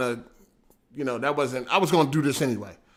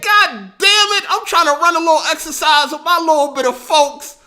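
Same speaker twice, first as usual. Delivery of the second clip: mock exasperation; 10 semitones higher, 6 dB louder than usual. Streamer retelling his complaint to Your Rage, hammed-up high whine. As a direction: whine it like a wronged kid. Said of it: "I said".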